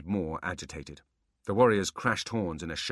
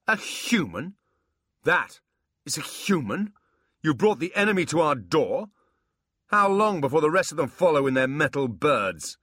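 loud, high outraged demon; 9 semitones higher, 8 dB louder than usual. Acted. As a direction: rage at the intruder. Abbott outraged at a human.

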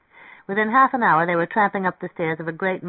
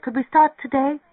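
put-on high, keen young woman's plea; eager; 10 semitones higher, 4 dB louder than usual. A narrator's reading. Mary Ann asks Schilling to start today, high and eager. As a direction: ask brightly, pitch lifted, impatient to begin. Girl eager to paint at once.

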